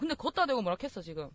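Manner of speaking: normal talking voice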